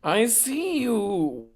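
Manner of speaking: high, slow Owen Wilson drawl; lazy surfer drawl; Owen Wilson impression. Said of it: "Oh my God, Owen Wilson?".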